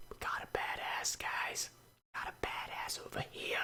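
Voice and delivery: hushed mock-impressed whisper. Real, not acted. YouTuber mocking an overpowered pony OC, in a whisper. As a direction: breathe it like a secret.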